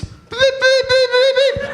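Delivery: high, loud imitated beeping; parking sensor beeps. Comedian imitating a car parking sensor, high beeps. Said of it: "hears the beeps".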